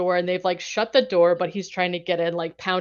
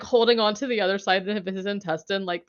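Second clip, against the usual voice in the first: normal talking voice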